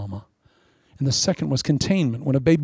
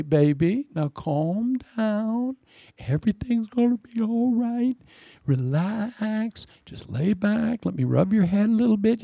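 high, slow, crooning mother voice; soothing church mother; 7 semitones higher, slower than usual. Acted. Church mother calming someone down, high soothing voice. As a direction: coax a baby to relax, drawn out and high. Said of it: "sometimes mothers in the church, that's their gift".

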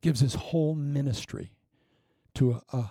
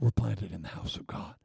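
whisper; 5 semitones lower, 5 dB quieter than usual. Acted. Tender point in sermon.

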